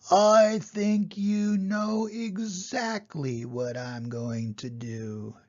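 slow, knowing menace; menacing boar. A narrator's reading. Boar confronts the thief menacingly.

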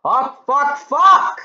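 rising excited yells; real loud outburst. Real, not acted. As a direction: yell it out in disbelief, getting bigger each time. Reacts loudly to the song.

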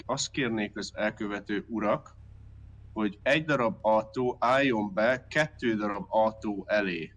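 ordinary talk, nothing marked

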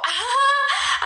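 a squealing laugh, high and loud; high burst of laughter. Real, not acted. Streamer laughs at a request to sing, high squeal of laughter.

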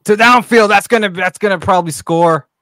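loud, high, rising home-run call; excited play-by-play call. Acted. Streamer calling the Twins run to chat, excited. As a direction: call the score like a hyped announcer.

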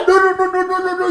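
high, loud mock panic; imitated frantic protest. Imitating co-star struggling against a kiss, frantic and high.

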